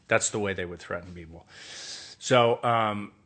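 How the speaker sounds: ordinary delivery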